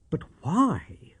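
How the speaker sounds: whispered question. A narrator's reading. Watson's hushed question.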